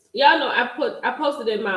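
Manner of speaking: normal talking voice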